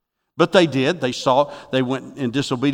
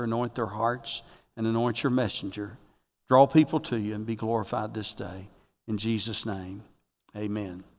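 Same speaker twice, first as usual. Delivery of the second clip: lower, much softer praying voice; hushed prayer; 5 semitones lower, 11 dB quieter than usual. Real, not acted. Preacher asking the Lord to anoint the word, hushed prayer. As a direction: pray quietly and low, addressing God.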